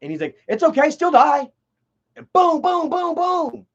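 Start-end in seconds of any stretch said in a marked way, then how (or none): 0.5-1.5 loud, offhand and merciless; the killer tells the girl she dies anyway, coldly; cold still die. Retold or imitated. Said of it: "it's pretty much that inflection too". 2.1-3.6 loud, high mock gunshots; voicing the shots at the girl with loud, high booms. Imitating gunshots in a retold shooting.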